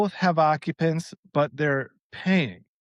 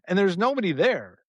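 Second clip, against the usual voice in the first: ordinary delivery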